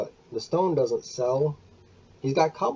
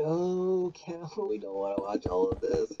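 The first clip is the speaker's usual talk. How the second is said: higher mock-whiny complaint with a laugh; bored viewers; 7 semitones higher, 6 dB quieter than usual. YouTuber imitating his eye-rolling viewers, higher mock whine. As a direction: play a bored viewer begging to skip ahead. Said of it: "you'll be rolling your eyes going".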